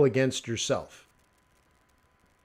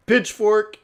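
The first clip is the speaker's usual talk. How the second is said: high, raised mob call; mock angry locals; 6 semitones higher, 5 dB louder than usual. Imitating offended Dominicans going for weapons, mock call. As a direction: call it out in a put-on voice. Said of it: "They're like".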